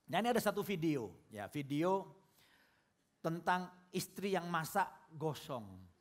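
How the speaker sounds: usual speaking voice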